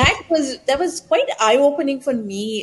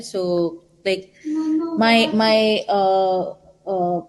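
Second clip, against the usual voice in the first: ordinary talk, nothing marked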